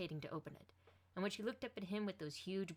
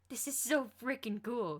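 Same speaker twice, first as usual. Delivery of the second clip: slow, raised, thrilled delight; excited; 5 semitones higher, 8 dB louder, slower than usual. A narrator's reading. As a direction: savour every word with glee. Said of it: "and he just laughed".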